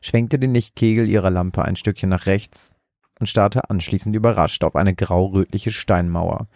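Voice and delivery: plain reading voice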